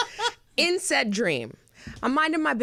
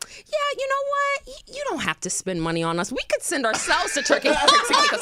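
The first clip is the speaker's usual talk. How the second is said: high, sly mock-generous offer; high, cheeky voice that breaks into a laugh at the end; Gandhi impression; 10 semitones higher than usual. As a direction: sweetly suggest it, angling for a free trip. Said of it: "in true Gandhi form, goes".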